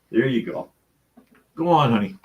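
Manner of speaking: high cooing to the cat. Real, not acted. Talking to the cat.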